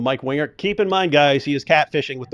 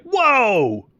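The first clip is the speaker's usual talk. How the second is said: mock amazement; 8 semitones higher than usual. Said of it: "you're gonna be like".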